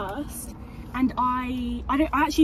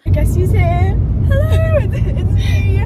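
excited; 7 semitones higher, 9 dB louder than usual. Real, not acted. Greeting on camera arrival.

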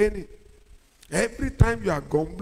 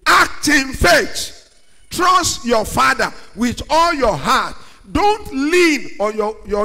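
slow, loud, higher exhortation; preaching shout; 4 semitones higher, 9 dB louder, slower than usual. Acted. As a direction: cry out the call to faith.

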